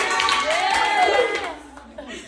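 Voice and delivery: loud embarrassed laugh; nervous laugh. Real, not acted. Teammate about to rap for Lydia, nervous laugh. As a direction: laugh before performing the rap.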